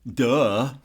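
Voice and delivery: loud, mocking retold duh; scornful duh. Star dismissing the photographer before walking away, retold scornful duh.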